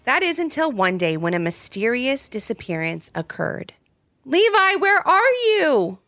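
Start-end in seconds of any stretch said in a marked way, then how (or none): 4.3-6.0 high sing-out call, stretched at the end. A narrator's reading. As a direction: call high through the house for a hidden brother. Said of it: "called out Ivy".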